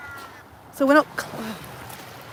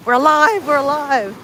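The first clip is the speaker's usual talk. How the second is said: mock cheer; 3 semitones higher, 5 dB louder than usual. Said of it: "it's like Live City at the moment".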